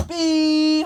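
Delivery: high, loud honk noise; car horn beep. Pretend. Kid playing a driver, honking at traffic with a loud beep.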